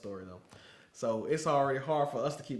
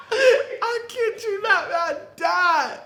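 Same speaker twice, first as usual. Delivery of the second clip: higher, louder, slow, laughing through words; 13 dB louder, much slower than usual. Real, not acted. Teller to listener, still laughing hard. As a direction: still cracking up as you say it.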